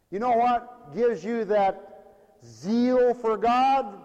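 stretched-out pulpit question; slow preached question. Acted. Preacher asking congregation, slow drawn-out question. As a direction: draw it out to the congregation.

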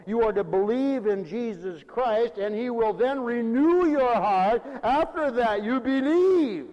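loud proclamation, rising and slowing to the end; forceful preaching. Real, not acted. Pastor corrects those waiting for softened hearts, forceful preaching. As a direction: build to a ringing, drawn-out climax.

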